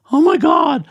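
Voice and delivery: very high imitation of horrified twins; mock panic. Twins realising Dad's car is wrecked, panicked. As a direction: wail it in hammed-up alarm.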